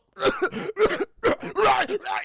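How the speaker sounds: high, loud retching coughs; imitated violent choking. Kane starts having convulsions, imitated violent choking. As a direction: act out a worsening choking fit.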